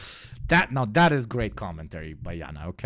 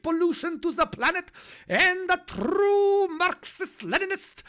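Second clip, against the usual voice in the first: much higher, put-on preachy comrade voice; ultra leftist caricature; 4 dB louder than usual. Streamer mocking commenters who scold him about cars, high preachy caricature. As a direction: lecture like a sanctimonious hardliner. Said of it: "That is the accent of my internal, like, ultra M L guy".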